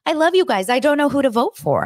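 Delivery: mimicking MJ's useless pleading in a high whine; mocking whine. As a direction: parody a helpless plea. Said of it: "she spent her time like".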